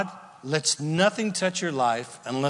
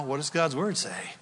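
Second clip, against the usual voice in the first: usual speaking voice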